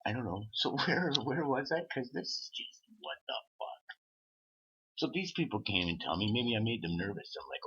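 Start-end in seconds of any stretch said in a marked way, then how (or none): 1.9-3.9 soft unvoiced what the fuck; whispered disbelief. Real, not acted. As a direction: breathe it out in disbelief. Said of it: "incredulous aside".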